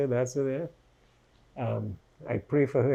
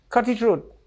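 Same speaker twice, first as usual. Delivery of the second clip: high, louder mock command; imitated order; 8 semitones higher, 6 dB louder than usual. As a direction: bark the order like the attacker. Attacker's order retold.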